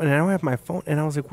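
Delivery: ordinary talk, nothing marked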